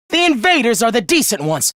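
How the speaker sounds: usual speaking voice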